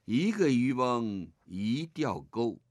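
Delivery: slow recitation, words spaced; poem recitation. Shown as a demonstration. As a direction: model the line slowly for the class. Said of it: "第二句". (放慢速度范读诗句)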